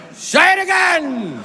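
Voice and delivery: high, drawn-out shout; hammed-up movie star bellowing an order; Al Pacino. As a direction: stretch the command out in a high holler. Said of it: "Al Pacino says".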